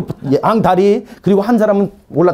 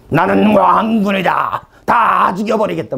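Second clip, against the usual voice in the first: slow, drawn-out demon roar; imitated demon threat, words stretched out; shouting demon; slower than usual. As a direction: bellow it slowly as a menacing evil spirit. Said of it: "그 소리를".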